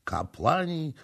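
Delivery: high, halting echo; stunned repetition. A narrator's reading. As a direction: repeat it in disbelief. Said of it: "powtórzył książę".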